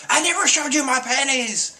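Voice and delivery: high-pitched outraged denial; imitated outburst of indignation; mock indignant yell. Acted indignant retort after kick.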